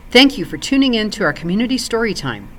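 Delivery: plain reading voice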